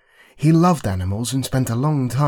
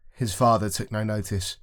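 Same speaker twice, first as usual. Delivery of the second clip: plain reading voice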